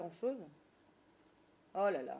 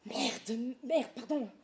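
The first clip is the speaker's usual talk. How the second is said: ordinary delivery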